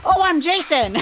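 high excited shout with laughter; excited laughing. Real, not acted. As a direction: cry out in excited delight. Excited game exclamation with laugh.

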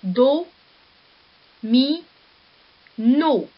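"Do", "mi", "no" each said alone, loud, with pauses between; syllables spelled out. Shown as a demonstration. Teacher breaking a word into syllables for pupils, loud and separate. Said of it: "trois syllabes".

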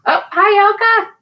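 high, bright gasp of greeting; delighted surprise. Real, not acted. Host spotting a viewer's name, surprised and delighted. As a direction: gasp and greet a friend you didn't expect.

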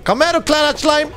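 high mocking taunt to prey; taunting monster. Monster taunts a fleeing player, high mocking call.

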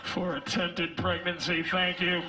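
low, even intercom announcer; store PA announcement. Pretend. Sperm paged over the intercom, flat announcer voice. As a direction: read it like a store loudspeaker. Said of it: "shit comes out like".

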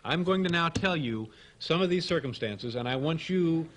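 ordinary talk, nothing marked

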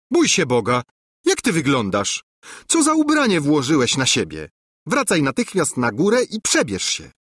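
high, loud scolding princess; a princess appalled at his clothes. A narrator's reading. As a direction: scold him as a shocked princess.

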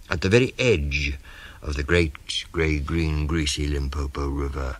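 usual speaking voice

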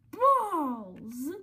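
sudden loud yelled curse; loud frustrated exclamation. Real, not acted. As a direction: blurt it out at full volume. Cursing at the fire trap.

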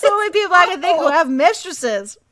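high, loud, laughing through the words; laughing protest. Real, not acted. Laughing defending her accent.

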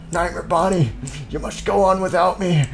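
high, strained melodrama of a wounded man; milk the dying moment; hammed-up dying soldier. Wounded soldier to Nightmare Bonnie, hammed-up dying plea. Hammy death scene.

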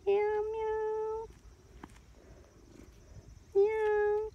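high, loud mewing meows; cat meow. Pretend. Meowing loudly back at the cat.

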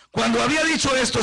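usual speaking voice

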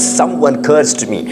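ordinary delivery

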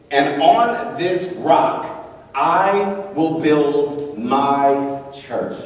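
very slow, each word spaced out; slow weighty reading of the verse; slow solemn quote. Acted. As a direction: lay each word down slowly. Preacher quoting Jesus slowly.

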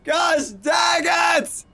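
angry yell. Real, not acted. Real yell at the game after falling again. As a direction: let it burst out.

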